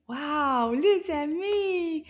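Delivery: ordinary delivery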